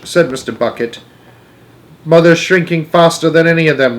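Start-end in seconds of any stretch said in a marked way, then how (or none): 2.0-4.0 raised, distressed wailing. A narrator's reading. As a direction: wail it in dismay. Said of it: "wailed Missus Bucket".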